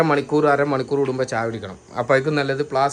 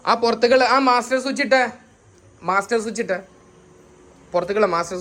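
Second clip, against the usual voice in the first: raised-pitch call to someone; higher-pitched practical request, called out; 7 semitones higher than usual. Real, not acted. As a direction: call out an instruction across a distance. Calling to switch something on.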